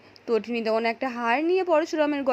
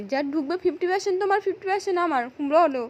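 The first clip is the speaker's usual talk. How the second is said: higher, insistent mock shout; retold shouting; 5 semitones higher than usual. A narrator's reading. Villain pressing the husband to split the money, mock shouting. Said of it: "এই বলে তো চিৎকার করে বারবার বলতে থাকে".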